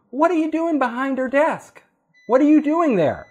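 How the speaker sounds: high, puzzled mock question; puzzled readers. Other readers quizzing the newcomer at her desk, high and puzzled. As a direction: imitate coworkers baffled to find him there.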